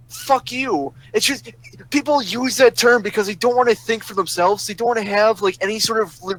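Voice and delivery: worked up and fed up; exasperated rant. Real, not acted. Heated rant about being called troll.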